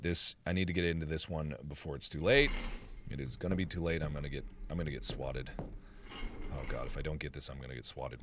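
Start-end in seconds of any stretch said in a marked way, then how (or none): 3.1-8.2 low, very soft worried mumble; anxious muttering. Real, not acted. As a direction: mutter it to yourself. Dreading being swatted in game.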